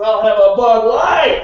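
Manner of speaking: slow, loud blurt; loud order. Retold or imitated. Customer ignoring the whole beer list, slow loud blurt. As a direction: bark the order out bluntly. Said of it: "and he goes".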